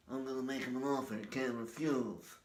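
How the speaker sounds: lightly voiced mob boss; Godfather impression. Vlogger doing the Godfather in a light put-on voice.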